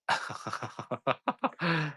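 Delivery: high laugh that fades off. Real, not acted. Amused at her communism joke, soft high laugh.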